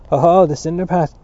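loud, crowing disbelief; cried scornfully. A narrator's reading. Prefect catching out a boy's excuse, crying out. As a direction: pounce on the excuse, loudly. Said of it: "cried the Prefect of Studies".

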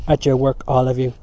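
loud command to a whole class; shouted order. A narrator's reading. Prefect ordering the class back to work, shouted. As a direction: raise the voice to order them. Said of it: "shouted the Prefect of Studies".

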